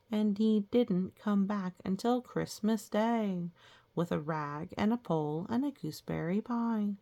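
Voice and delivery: ordinary delivery, nothing marked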